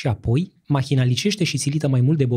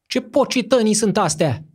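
high furious shout at the monks; 9 semitones higher than usual. A narrator's reading. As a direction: bellow it out in sudden rage. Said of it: "strigă".

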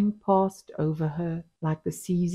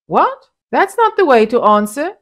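sharp, high, loud outrage; indignant; 8 semitones higher, 9 dB louder than usual. A narrator's reading. Offended husband scolding his wife, high and loud. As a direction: bristle at her reply. Mustapa indignant at her answer.

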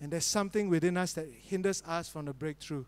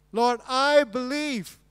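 slow, much louder and higher "Lord, I believe"; proclaimed belief; 7 semitones higher, 11 dB louder, much slower than usual. Shown as a demonstration. Preacher voicing the healed man, slow loud proclamation. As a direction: declare it out boldly.

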